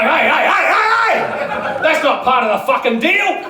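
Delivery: high, loud panicked yelling; alarmed shout. Retold or imitated. Man protesting to the pole dancer, high and loud. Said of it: "I'm like".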